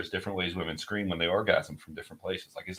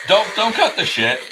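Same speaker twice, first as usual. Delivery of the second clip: high, loud laughing tease; 11 semitones higher, 10 dB louder than usual. Real, not acted. Husband laughing as he teases his wife. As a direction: laugh through the words.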